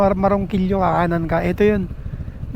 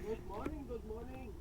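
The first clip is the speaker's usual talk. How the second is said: quiet greeting; 2 semitones higher, 32 dB quieter than usual. Real, not acted. Late-night greeting on quiet road.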